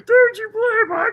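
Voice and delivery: high, slowish mocking voice; mocking her. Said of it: "She told, she told people".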